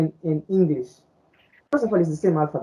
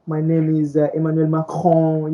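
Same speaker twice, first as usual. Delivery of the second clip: mimicked French-accented English. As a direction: imitate a Frenchman introducing himself in English. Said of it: "they have that accent, you know, that French accent".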